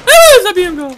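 high, loud squeal dropping into words; excited cheer. Real, not acted. Gamer announcing his kill to the team, excited.

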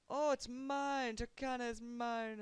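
high, loud, drawn-out victory cry; triumphant shout. Real, not acted. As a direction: gloat over the conquest, slowly. Capturing the city.

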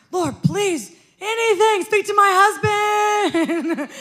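high, loud, slow crying out to God; desperate prayer. Retold or imitated. Praying for God to speak to her husband, high and loud. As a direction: beg the Lord, stretching each word. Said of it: "'Cause I've been calling out".

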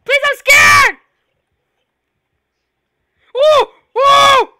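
high frightened shouting; scared screaming. Real, not acted. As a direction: yell in real fear. Screaming at the killer clown.

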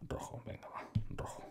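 real whisper, off to the side; whispered aside. Real, not acted. Streamer aside picking the colour red, whispering. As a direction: murmur it under your breath, not for the audience.

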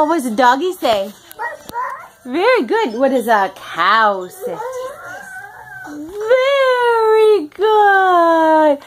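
put-on high sing-song for a toddler; reading to a toddler. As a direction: coax the little one brightly. Mother quizzes toddler on animal sounds.

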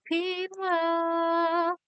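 very slow, high, drawn-out; sing-song greeting. Real, not acted. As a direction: croon the name fondly. Greeting a viewer warmly.

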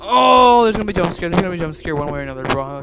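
high, loud wail rushing onward; panicked dread. Real, not acted. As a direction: cry out, then babble in alarm. Dreading a jump scare.

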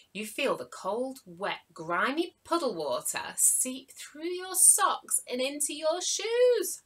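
ordinary delivery

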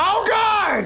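high loud scared cry; scared shout. Real, not acted. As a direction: cry out in fright. Scare in game.

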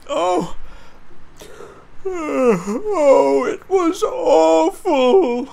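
high, loud wail in Percy's voice; drawn-out, shaken little engine's wail; distressed cry. A narrator's reading. Sleepy Percy telling Toby about last night, high wailing cry. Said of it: "cried a sleepy Percy".

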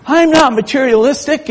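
high indignant denial. Retold or imitated. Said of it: "he said".

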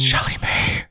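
hushed whisper of a name. Real, not acted. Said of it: "Why did you whisper it to me?".

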